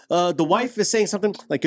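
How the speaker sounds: ordinary delivery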